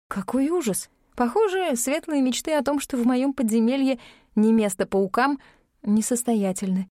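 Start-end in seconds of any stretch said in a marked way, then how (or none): none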